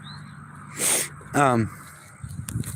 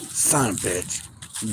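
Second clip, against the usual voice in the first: muttered curse; 5 semitones lower, 9 dB quieter, slower than usual. Real, not acted. Dogs barking, muttered.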